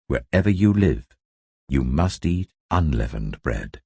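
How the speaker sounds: ordinary delivery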